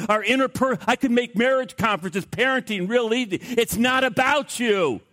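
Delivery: high, driving sermon delivery; impassioned preaching. Real, not acted. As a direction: hammer each repeated word with high-pitched fervour. Preaches at full force.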